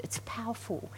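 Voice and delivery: whispered for weight; hushed aside. Real, not acted. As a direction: drop to a breath to stress it. Preacher drops to hush.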